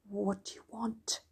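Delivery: soft breathless whisper; frightened whisper through a door crack. Acted. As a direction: hush it as if the bear might hear. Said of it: "he whispered".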